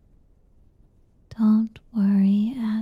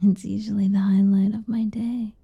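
ASMR whisper. Acted. Mother whispers to her boy at bedtime, intimate ASMR whisper. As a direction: whisper tender nothings to lull him.

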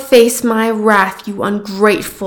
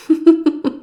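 evil laugh; 6 semitones higher than usual. Acted. Goddess amused by a volunteer.

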